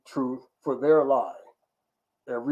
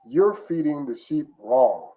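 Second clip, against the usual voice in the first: usual speaking voice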